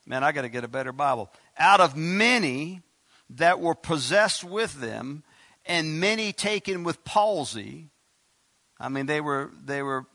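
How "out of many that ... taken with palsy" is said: slow, loud verse reading; proclaimed scripture reading. Acted. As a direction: draw the verse out for the congregation. Reads the verse aloud to congregation.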